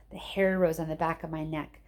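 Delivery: usual speaking voice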